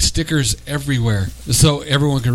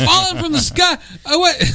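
much higher, playful mock alarm; high mock shriek. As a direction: squeal it out in mock shock. Quoting the turkey bit.

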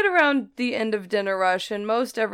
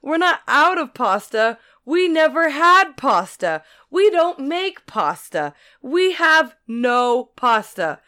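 each pasta line slower, higher and louder; exasperated emphatic clerk; 5 semitones higher, 5 dB louder, slower than usual. Retold or imitated. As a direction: hammer every line, patience gone. Clerk losing patience.

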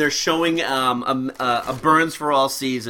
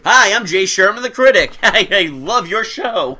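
Jay Sherman impression; 5 semitones higher, 5 dB louder than usual. Quoting Jay Sherman character.

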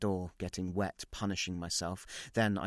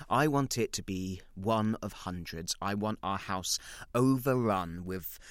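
ordinary delivery

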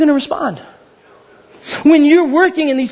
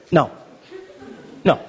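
normal talking voice

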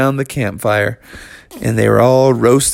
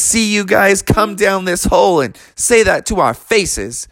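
cocky mole; 7 semitones higher than usual. Acted. Mole dares bears.

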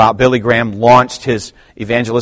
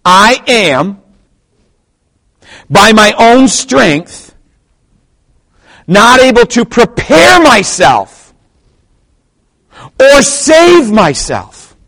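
loud, high, drawn-out phrases with long pauses; slow emphatic delivery; 12 semitones higher, 10 dB louder, much slower than usual. Shown as a demonstration. Preacher slows it down for the church, heavily emphatic. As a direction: hammer each phrase slowly, pausing between them. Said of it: "slow it down for you and say it with emphasis".

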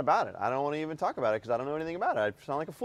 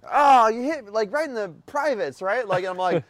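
high, strained mock-pained voice; high wounded whine at his daughter; hurt whine; 7 semitones higher, 4 dB louder than usual. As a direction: squeak it out like you just got hit. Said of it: "I was like".

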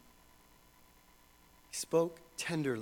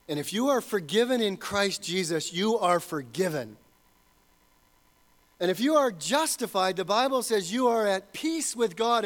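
louder, ringing assurance; preaching at full force; 6 semitones higher, 5 dB louder than usual. Real, not acted. Pastor assuring believers of God's pardon, full-voiced preaching. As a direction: preach the assurance out to the back row.

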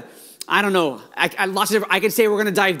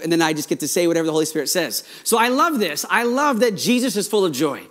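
normal talking voice

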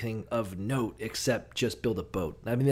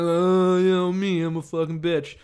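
higher put-on voice shrugging it off; mock Abraham; 5 semitones higher, 4 dB louder than usual. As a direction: play Abraham as a sheepish goof.

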